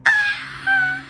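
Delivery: piercing high scream from the gut; imitated anguished scream. Imitating a retreat participant's loud high scream. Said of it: "The loudest scream you could ever hear".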